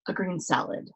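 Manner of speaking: plain reading voice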